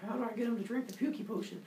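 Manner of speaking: high, quick aside; scheming aside. Acted. Zeus puzzles over tricking his dad, high scheming aside. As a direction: wonder it aloud, quick and high.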